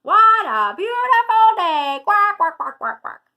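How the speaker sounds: high, very loud, exuberant duck; shouting duck. A narrator's reading. Dottie enjoying the park beside Quincy, shrieked at full volume. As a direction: holler it, then quack away. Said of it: "shouts Dottie".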